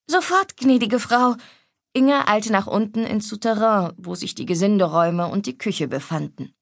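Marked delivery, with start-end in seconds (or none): 0.1-1.3 lightly voiced maid, higher pitch; prompt, high reply of a servant; eager maid. Maid answering the lady of the house, lightly voiced as eager.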